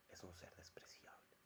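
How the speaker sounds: hushed, very soft stage whisper; contemptuous whisper. Acted. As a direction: whisper the scorn as a confidence. Poet confides contempt for his double.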